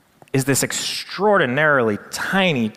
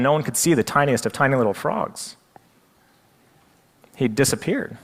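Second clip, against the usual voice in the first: usual speaking voice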